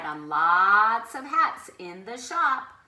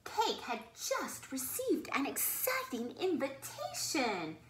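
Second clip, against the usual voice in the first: whisper; 7 dB quieter than usual. A narrator's reading. Whispered opening of story.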